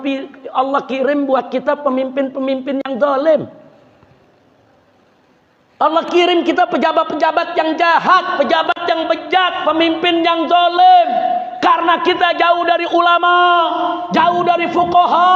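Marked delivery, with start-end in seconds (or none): 5.7-15.4 preaching shout. Real, not acted. Preacher rising to condemn leaders.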